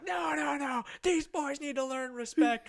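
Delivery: high, loud drawn-out mock shout; imitated angry dad. Angry dad rejecting wife's plea, imitated anger. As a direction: refuse furiously, in a hammed-up rage. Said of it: "You can just see the anger".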